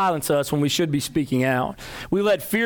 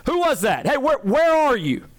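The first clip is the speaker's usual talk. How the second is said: retold shout; 10 semitones higher than usual. Khrushchev shouts back at a heckler, hammed-up shout. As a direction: bark the challenge back at the crowd. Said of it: "He shouts back".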